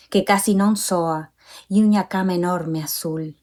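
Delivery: Galician read in a Uruguayan accent; Uruguayan-accented poetry reading. Shown as a demonstration. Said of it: "I'll read a little bit from the Galician in my Uruguayan accent".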